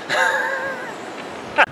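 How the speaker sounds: high-pitched peal of laughter; high, trailing laugh. Real, not acted. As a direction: burst out laughing after the flub. Laughing after a failed take.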